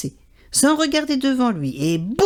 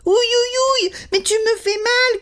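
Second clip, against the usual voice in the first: very high yelps of pain; magpie crying in pain; 13 semitones higher than usual. A narrator's reading. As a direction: bitten on the neck. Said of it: "crie la pie".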